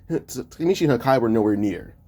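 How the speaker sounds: plain reading voice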